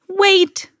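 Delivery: high, urgent call; crying out. A narrator's reading. Camilla stopping the old woman at the door, crying out. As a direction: call out to stop someone leaving. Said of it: "she cried".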